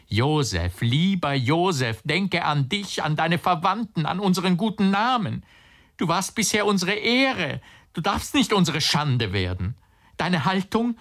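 high, urgent appeal climbing in pitch; agitated pleading. A narrator's reading. Agitated uncle pleads with nephew. (eindringlich flehend, immer höher)

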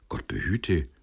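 mild, quick protest; a touch of polite dismay; warding off. A narrator's reading. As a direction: deflect it modestly. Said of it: "wehrte Sender ab".

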